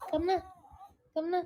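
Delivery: high coaxing pet call; sweet call to dog. Real, not acted. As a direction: coax the dog over. (malambing na pagtawag sa aso)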